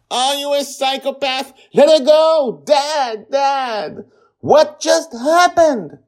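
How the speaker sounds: very high, loud frantic cries; overlapping screaming. A narrator's reading. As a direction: scream like a whole panicked family. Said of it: "In all the screaming".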